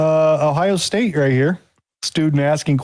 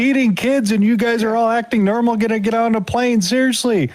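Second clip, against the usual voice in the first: freaking out; 9 semitones higher than usual. Retold or imitated. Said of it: "freaks out and says".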